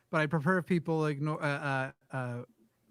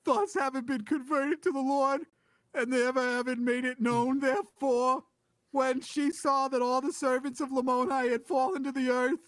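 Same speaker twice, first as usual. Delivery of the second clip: put-on higher scratchy Boston accent; mock old Boston voice; lightly hammed Bostonian reading; 7 semitones higher than usual. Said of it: "I was gonna do scratchy Bostonian".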